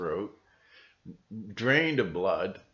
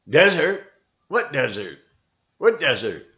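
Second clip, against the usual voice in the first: loud, higher bewildered retort; puzzled robot; 5 semitones higher, 9 dB louder than usual. Retold or imitated. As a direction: act baffled by the question. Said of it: "the interviewer goes".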